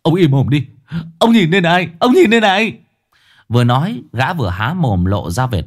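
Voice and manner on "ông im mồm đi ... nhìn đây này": loud, rising angry outburst; high exasperated shouting; glaring fury. Acted. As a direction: turn on him furiously, voice climbing. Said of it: "Kiên mắt long sòng sọc quay phát lại".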